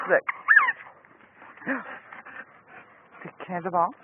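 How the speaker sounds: usual speaking voice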